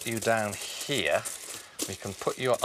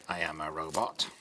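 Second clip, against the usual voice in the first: robot voice; 8 semitones lower, 7 dB quieter, faster than usual. Pretend. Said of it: "the robot can say".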